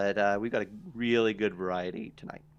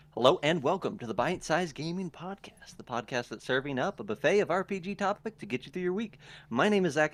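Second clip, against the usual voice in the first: higher, upbeat show-opening voice; podcast host intro; 5 semitones higher than usual. Real, not acted. Host welcoming listeners to a gaming podcast, announcer-style intro.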